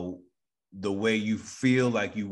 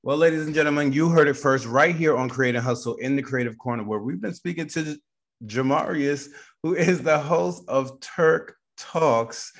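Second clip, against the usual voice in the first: louder, raised-pitch sign-off announcement; show host announcer; 4 semitones higher, 4 dB louder than usual. Acted. Host closing the episode for listeners, announcer style. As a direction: announce the guest like a showman.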